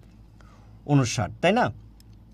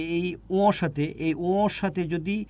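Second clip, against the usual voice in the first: slow emphasis; much slower than usual. Shown as a demonstration. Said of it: "slow pointing out ও".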